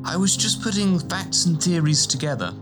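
high, tentative scholarly voice; hesitant. A narrator's reading. Scholar asked about his conundrums, hesitantly. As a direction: begin tentatively, playing it down.

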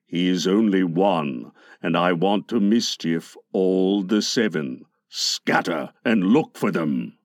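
very low pirate captain; sinister Captain Hook. A narrator's reading. Hook sends his pirates searching, low and dark. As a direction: give orders in a deep, dark voice.

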